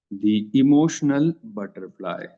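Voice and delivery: the emotional butterfly drawn out slowly; slow dictation for students to copy; slow, spelled-out name. Shown as a demonstration. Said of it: "क्या नाम है?".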